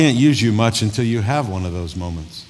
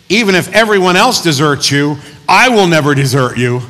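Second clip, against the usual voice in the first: loud, raised-pitch pledge of loyalty; bold vow; 7 semitones higher, 9 dB louder than usual. Acted. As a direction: declare it boldly, as an impetuous Peter. Peter jumps up boasting to Jesus.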